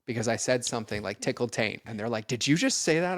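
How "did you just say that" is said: higher, indignant mock gasp of a question; mock shocked. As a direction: play the scandalized listener, pitched up. Offended person at event quoted.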